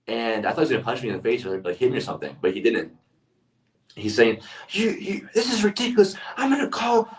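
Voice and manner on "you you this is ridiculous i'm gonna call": high, strained imitation of an irate customer; mock furious screaming. Irate customer tells off restaurant manager, mock furious screaming. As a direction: act out an outraged customer's rant, pitched way up. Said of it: "He was screaming in my face".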